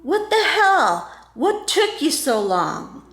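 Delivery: slow, drawn-out mock demand; high-pitched start, stretched-out indignant words; imitated loud voice. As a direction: imitate a friend hollering impatiently. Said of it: "a loud voice saying".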